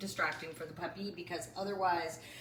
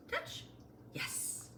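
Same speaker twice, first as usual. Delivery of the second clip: ordinary talk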